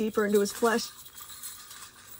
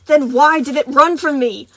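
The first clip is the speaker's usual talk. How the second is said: angry hiss; 4 semitones higher, 10 dB louder than usual. Acted. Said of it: "Moon hissed back".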